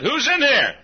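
high, loud call into a room. Retold or imitated. Preacher asks who is in his motel room, calling out. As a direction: demand an answer from someone unseen. Calls to unseen person.

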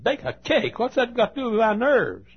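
high, disbelieving quoted question; incredulous woman. Retold or imitated. Pastor retelling the nervous woman's reply, high and incredulous.

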